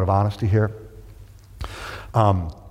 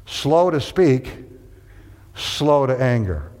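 very slow, each phrase weighed; slow, deliberate scripture recital; slow emphatic recitation; much slower than usual. Shown as a demonstration. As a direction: drag out slow to speak, slow to anger. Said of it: "Do this first".

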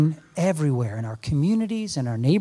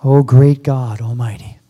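reverent whispered prayer; 4 semitones lower than usual. Real, not acted. Leads prayer over music.

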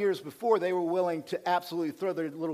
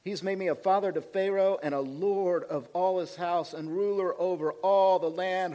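usual speaking voice

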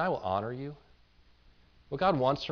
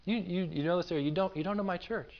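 soft, pitched up, half to himself; inner thought; 6 semitones higher, 5 dB quieter than usual. Retold or imitated. Pastor retelling his silent reaction to old classmates, soft and higher. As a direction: voice the thought you kept to yourself, hushed. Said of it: "I thought".

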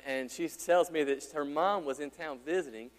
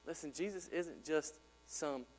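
earnest; 2 semitones lower, 8 dB quieter than usual. Real, not acted. Preacher tells the congregation Jesus knows them, soft and sincere. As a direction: draw them in quietly.

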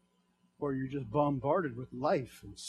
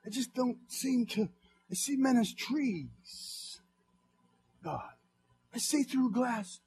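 stammered high confession building to a loud cry; plead, halting, then break out; struggling believer; 8 semitones higher, 8 dB louder than usual. Acted. Blind man admitting to God that he barely sees, rising to a cry.

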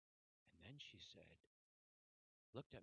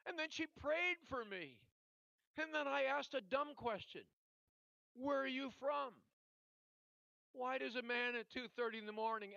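loud, raised-pitch storytelling from the pulpit; high, forceful preacher delivery; impassioned preaching; 9 semitones higher, 12 dB louder than usual. Real, not acted.

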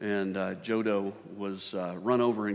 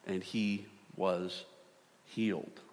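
slow reveal; 3 semitones lower, 7 dB quieter, slower than usual. Acted.

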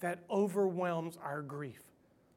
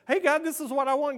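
mock demanding; 8 semitones higher, 7 dB louder, faster than usual. Demanding prayer acted out.

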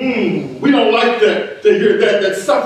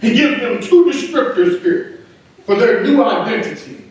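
ordinary delivery

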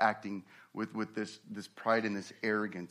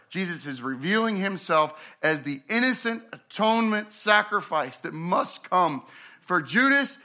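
preaching higher and louder, punching key words; impassioned preaching; 6 semitones higher, 8 dB louder than usual. Acted. Preacher stirring the congregation about Jesus' trial, impassioned. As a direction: drive the point home from the pulpit. Preaching builds to full force.

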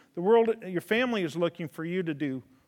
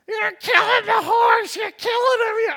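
much higher and louder mock girls' shriek; panicked teenage girls; 11 dB louder, slower than usual. Preacher voices teen girls screaming at him over the horse, in a high panicked shriek. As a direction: squeal in horror like frightened teens. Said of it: "fourteen teenage girls standing around".

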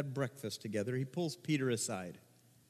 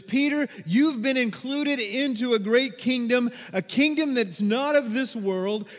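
raised, loud preacher's voice; impassioned preaching; 6 semitones higher, 6 dB louder than usual. Acted. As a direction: press the call home to the congregation. Preaches Jesus's charge to Peter.

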